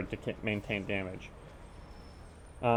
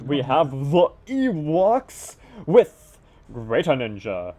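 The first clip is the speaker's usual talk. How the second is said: drawn-out, higher announcer delivery; announcer roll call; 4 semitones higher, 6 dB louder, slower than usual. Acted. Caster introduces the bottom-left team, announcer style. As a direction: stretch each player name like a ring announcer.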